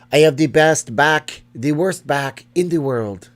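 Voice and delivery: slow, loud, exaggerated Quebecois French accent; bad Quebecois accent. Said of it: "That's my really bad Quebecois accent".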